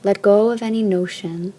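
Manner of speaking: ordinary talk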